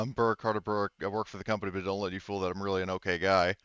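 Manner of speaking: normal talking voice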